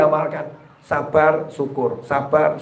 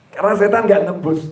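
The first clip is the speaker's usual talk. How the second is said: normal talking voice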